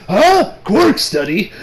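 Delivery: sudden high, loud "Huh?"; startled and puzzled; surprised old man. Retold or imitated. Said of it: "Gran is like".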